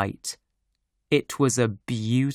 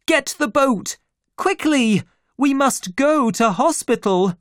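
urgent; 12 semitones higher, 4 dB louder than usual. A narrator's reading. Urgent orders in a crisis.

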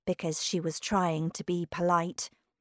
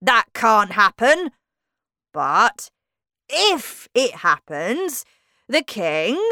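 indignant boasting; 3 semitones higher, 8 dB louder, slower than usual. Acted. Humpty indignantly boasts of the king.

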